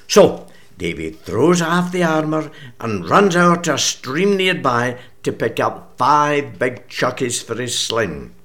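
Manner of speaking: broad, energetic Scots recitation; story recitation. Acted. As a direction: tell it like a party piece to an audience. Recites Scots Bible story piece.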